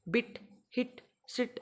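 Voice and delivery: बिट, हिट, सिट each with a short i; teacher's short-vowel examples बिट, हिट, सिट; short 'i' demo. Shown as a demonstration. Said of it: "जहाँ पे small ई का जो है pronunciation हो रहा हो".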